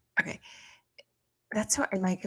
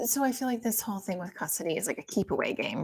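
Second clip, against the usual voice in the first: usual speaking voice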